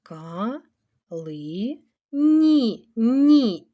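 "Ка-ли-ні" in separate slow syllables, last "Ні" loud; "Ка-ли-ні" broken up for writing. Shown as a demonstration. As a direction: dictate "Ка-ли-ні" with pauses, repeat "Ні" firmly. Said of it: "І так, велику літеру не пишемо".

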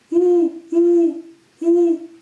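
high, loud hooting; owl hoot. Shown as a demonstration. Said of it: "然后会发出呜的声音".

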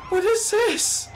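very high, drawn-out squealed yell; bewildered shriek. Real, not acted. Gamer baffled by the game's chaos, shrieking.

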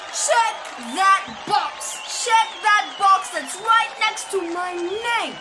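loud campaign rally shouting; rally speech. Acted. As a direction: pump up the crowd, yelling. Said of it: "practicing my campaign speech to the people".

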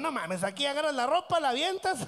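ordinary delivery

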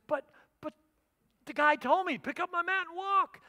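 flustered protest. Retold or imitated. Man protests to leaders.

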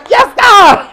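very high exclaiming cry; excited shout. Real, not acted. Shaking off a heavy moment for the audience, high excited shout. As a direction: shout it out in delight.

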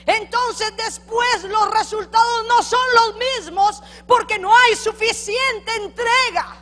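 high, loud, slow fiery preaching; preacher driving home the point to the congregation, fiery; preaching at full force. Acted. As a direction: thunder it from the pulpit. Preaching climax.

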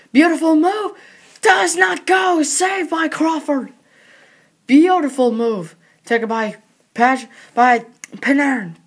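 rapid live hockey play-by-play; frantic mock sports commentary. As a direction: call the game as it happens.

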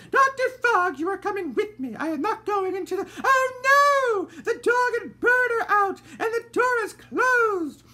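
very high, loud, distressed cry; fussy lady losing her composure; panicked lady. A narrator's reading. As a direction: wail in alarm at the shut door. Lady Dandelion panics at door.

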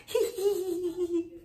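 high giggling hee hee; high tittering laugh; pig giggle. A narrator's reading. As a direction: giggle at the wolf. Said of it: "laughed the three little pigs".

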